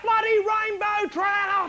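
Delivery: high, slow mock warning shout; yelled out. Swimmer yelling a silly warning to the beach, high shout. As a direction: holler it slowly as a beach alarm. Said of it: "You couldn't yell out".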